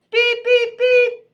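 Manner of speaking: very high, loud electronic beeps; robot beeping. Acted. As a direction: beep piercingly like a machine. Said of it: "beeped Robot".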